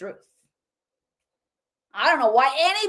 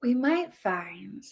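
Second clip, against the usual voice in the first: slow, softer parody of a soothing speaker; mock gentle voice; 6 dB quieter, slower than usual. Shown as a demonstration. Commentator demonstrates how the speaker talks, soft and mocking. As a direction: soften and slow down as if nobody could object. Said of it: "if you talk like this".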